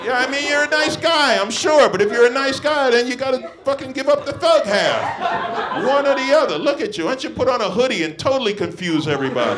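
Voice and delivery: high, loud comic roast of the man in the crowd; mock outraged rant. Real, not acted. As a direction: yell at him in disbelief, laughing. Ranting at an audience member.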